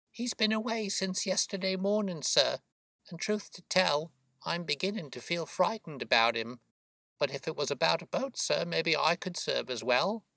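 high, fretful working woman's voice; worried boatman's wife. Acted. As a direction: confide worry about a husband, pitched high. Voices Mrs Smith worrying.

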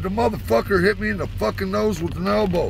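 high agitated protest; retold complaint. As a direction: complain loudly to a friend. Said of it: "I said".

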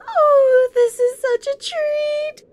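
high, loud, slow gleeful purr; gleeful villain. Acted. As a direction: savor it like a giddy sadist. Gleeful villain anticipating violence.

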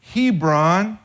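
ordinary talk, nothing marked

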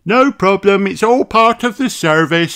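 a cheerful rabbit lady; Miss Rabbit. A narrator's reading. Miss Rabbit greets the children, high chirpy voice. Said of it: "says Miss Rabbit".

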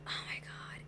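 very soft, voiceless whisper; hushed, barely audible disbelief; stunned whisper. Retold or imitated. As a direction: whisper it as a retold stunned reaction. Her own stunned reaction, retold.

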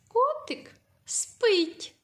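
high, very slow, котик lifted; singsong example. Shown as a demonstration. Teacher to young pupils, showing a sentence high and slow. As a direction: coo it high and slow like to a toddler. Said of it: "Ось таке речення".

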